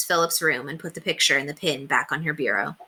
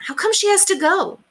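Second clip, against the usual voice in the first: put-on boy's voice; 4 dB louder than usual. A narrator's reading. Boy voice.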